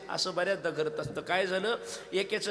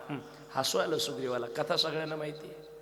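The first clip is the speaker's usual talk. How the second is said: usual speaking voice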